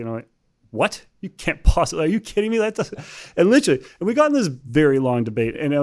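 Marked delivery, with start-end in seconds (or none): 0.7-3.8 retold disbelief. Recounting his reaction to the visitors' risk model, rising mock incredulity. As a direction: pitch climbing higher and louder in mock disbelief.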